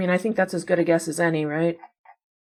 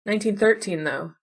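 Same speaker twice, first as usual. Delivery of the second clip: ordinary talk, nothing marked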